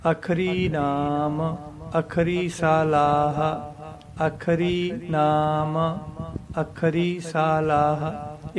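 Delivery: slow, half-sung, with a clear pause after ਅੱਖਰੀ and after ਨਾਮ; pause after each word. Shown as a demonstration. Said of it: "ਅੱਖਰੀ ਤੇ ਵੀ ਥੋੜ੍ਹਾ ਰੁਕਣਾ". (ਅੱਖਰੀ ਤੇ ਨਾਮ ਤੇ ਰੁਕ ਕੇ ਹੌਲੀ ਬੋਲੋ)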